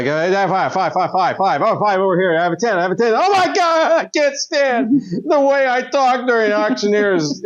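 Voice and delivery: frantic auctioneer cracking up. Acted. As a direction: rattle off bids then unravel. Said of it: "An auctioneer having an existential crisis".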